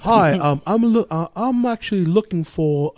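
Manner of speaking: mock British prank-call voice; British accent. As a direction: fake a polite British accent on the phone. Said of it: "I did it like a British accent".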